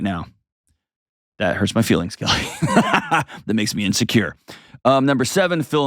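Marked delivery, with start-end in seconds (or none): 2.5-3.4 light amused laugh; short laugh. Real, not acted. Host laughing as Kelly points at him.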